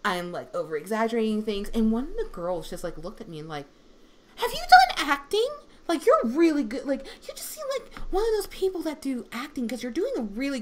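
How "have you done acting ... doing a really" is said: high, loud, amazed schoolgirl; imitating excited student. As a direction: gush in a high voice as an impressed student.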